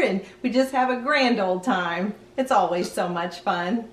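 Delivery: usual speaking voice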